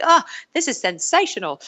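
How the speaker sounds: lightly imitated Australian accent, high and bright. Imitating the Australian castmate savoring food, Australian accent. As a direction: gush about the food in a mock Australian accent. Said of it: "you can judge my Australian accent".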